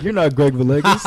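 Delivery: laughing, high and loud; half-laughing I'm-not-buying-it; laughing disbelief. Retold or imitated. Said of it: "she was like".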